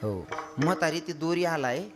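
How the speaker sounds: ordinary delivery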